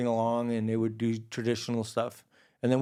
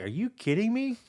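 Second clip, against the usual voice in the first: high-pitched incredulous question; mock astonishment, voice jumping up; retold disbelief; 12 semitones higher than usual. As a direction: act out being amazed.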